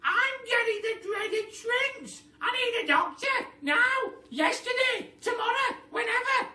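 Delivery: very high, loud hysterical wail; shrieking Mrs Twit. A narrator's reading. Missus Twit demanding a doctor, hysterical shriek. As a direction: shriek it in rising panic. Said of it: "said Missus Twit".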